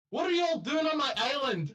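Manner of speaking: high, fast Scottish challenge; Scottish accent. Acted. Kobold on the ledge challenging the party in a Scottish accent. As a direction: demand it in a broad Scottish accent. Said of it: "I can't get over the accent".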